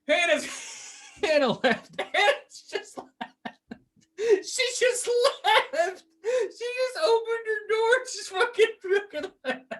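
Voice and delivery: very high, squeaky laughing wail; breaking up in high-pitched hysterics; helpless laughter. Real, not acted. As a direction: struggle to get the words out through laughter. Co-host walks out mid-rant.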